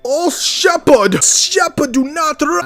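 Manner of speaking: high, loud, slow crazed preacher voice; priest impression. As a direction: ham up the mad priest calling out. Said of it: "I'm doing that accent".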